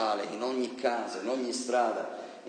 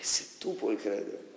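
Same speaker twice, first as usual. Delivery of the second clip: whispered; 2 semitones lower, 6 dB quieter than usual. Shown as a demonstration. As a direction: whisper it, slow and intimate. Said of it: "correcting the quote". (sussurrato lentamente)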